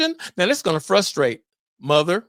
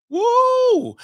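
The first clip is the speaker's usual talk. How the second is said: high, loud whoop of excitement; excited whoop; 5 dB louder than usual. Real, not acted. Podcast host whoops, fired up before the last episode. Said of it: "I'm getting excited".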